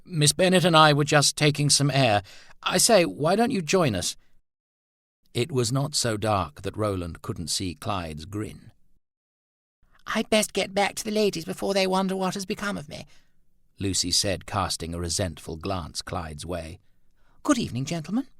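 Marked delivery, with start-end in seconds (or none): 0.1-4.1 relieved. Acted. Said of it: "unmistakable note of "thank God". 10.1-18.3 much higher, quick and clipped; a girl leaving, annoyed at the intruder; resentful young lady. Acted.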